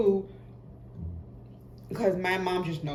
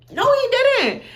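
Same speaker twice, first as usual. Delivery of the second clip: loud, high, drawn-out disbelief; outraged friend; 9 dB louder, slower than usual. Retold or imitated. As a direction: shriek it in disbelief.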